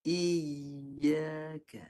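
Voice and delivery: "Iyyaka" yy held without stopping the voice; "Iyyaka" with a smooth unbroken yy; no stop. Shown as a demonstration. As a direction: keep the voice going through "Iyyaka". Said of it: "tukar dengan ya".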